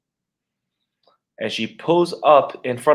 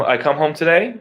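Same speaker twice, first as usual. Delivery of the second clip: ordinary talk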